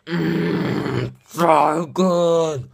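loud, savoring yum; delighted. Real, not acted. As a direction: shout your delight at the taste. Tasting delicious food.

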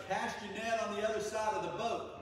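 called out high and loud across the water; calling from shore. Retold or imitated. Man on the shore calls out high to the fishermen. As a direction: call it out to the boat. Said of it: "the guy goes".

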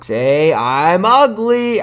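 stretched out, high and loud; singsong "say cheese" style, very slow; drawn-out photo prompt. Pretend. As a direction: drag each word out loudly for the camera. Mock photo prompt to strangers.

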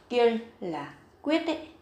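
ordinary talk, nothing marked

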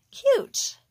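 high and loud burst of delight; delighted squeal. Real, not acted. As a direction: squeal it out, pleased with yourself. Delighted at finished nail.

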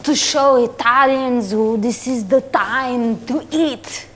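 lightly put-on Italian accent; broken English with an Italian lilt. Italian pasta boss scouting a model, Italian accent.

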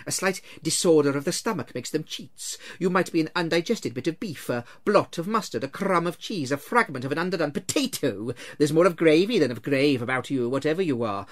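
brushing off the ghost with nervous bluster; Scrooge, lightly voiced. Scrooge scoffs at Marley's ghost, mildly voiced.

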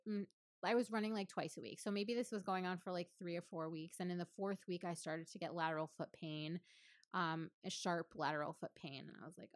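normal talking voice

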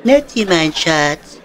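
slow, drawn-out mocking repeat; a hint of a sappy lover, dragged out; mock echo. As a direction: parrot his sweet talk back, slowly teasing. Mocking the other's sweet call.

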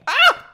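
sudden high yelp of fright; sharp startled shriek; startled scream. Real, not acted. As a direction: yelp like something jumped you.

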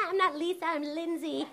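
high, put-on kid voice; mischievous schoolkids. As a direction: mimic giggly pupils fooling a teacher. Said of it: "They're all like".

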